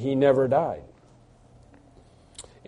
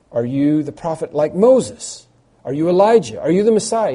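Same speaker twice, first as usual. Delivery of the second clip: building higher and louder questions; pressing questions; 3 semitones higher, 6 dB louder than usual. Retold or imitated. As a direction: fire the questions faster and harder. Crowd pressing John.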